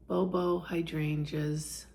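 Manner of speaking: normal talking voice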